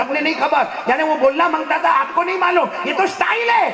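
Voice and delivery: high-pitched imitation of a protesting youth; mock indignant retort. Youth telling the preacher it is fashion, mock indignant. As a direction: protest it high and animated, for laughs.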